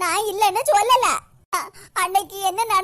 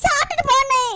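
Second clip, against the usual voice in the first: tearful pleading; 3 semitones higher, 3 dB louder than usual. Acted. Sister begs brother to stay.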